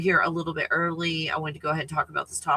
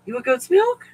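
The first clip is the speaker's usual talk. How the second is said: high, louder pet talk; 8 semitones higher, 5 dB louder than usual. Real, not acted. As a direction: coo the question up to the dog. Talking to her dog.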